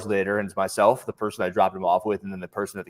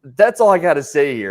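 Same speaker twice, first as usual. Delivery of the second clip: dismissive retort; 5 semitones higher, 8 dB louder than usual. Retold or imitated. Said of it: "I was like".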